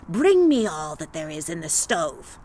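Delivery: low, demanding hag; old witch voice. Acted. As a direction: order it like a hungry witch. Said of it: "said the witch".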